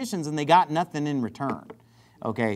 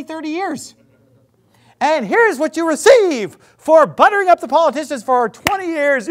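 fiery preaching; 8 semitones higher, 5 dB louder than usual. Acted. Preacher mocking a church's politician flattery, high loud sarcastic sermon. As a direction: preach it with mounting scorn.

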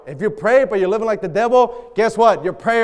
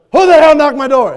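loud, high-pitched mock outrage; acted angry shout; pissed homeowner; 6 semitones higher, 9 dB louder than usual. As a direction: yell like an irate man at his door. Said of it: "the guy's pissed".

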